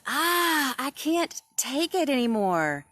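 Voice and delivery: high, loud fed-up cry; frustrated wail. Acted. Moody Cow losing his temper, high loud wail. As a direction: burst out like a kid at the end of his rope. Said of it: "So Moody Cow says".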